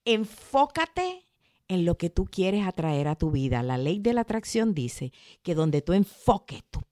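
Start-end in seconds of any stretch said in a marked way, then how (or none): 0.0-1.2 enfócate stretched slow and high; slow emphasis. Shown as a demonstration. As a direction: draw out enfócate slowly for emphasis. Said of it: "enfócate drawn out".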